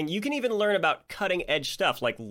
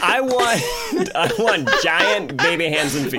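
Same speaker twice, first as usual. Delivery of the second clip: put-on childish wish voice; 3 semitones higher, 7 dB louder than usual. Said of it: "You were like".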